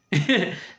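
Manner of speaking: laughing. Real, not acted. Laughs at quote.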